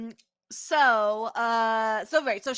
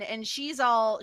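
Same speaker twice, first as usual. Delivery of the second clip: normal talking voice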